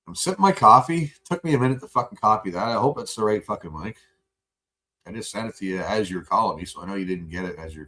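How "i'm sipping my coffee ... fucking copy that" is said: pointed retort. Real, not acted. Still venting at caller.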